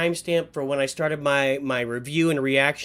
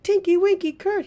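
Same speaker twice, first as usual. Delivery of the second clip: much higher, sing-song cartoon voice; hammed-up high Teletubby chant; silly character voice; 4 dB louder than usual. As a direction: chirp it like a giddy kids' TV character. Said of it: "but he was like".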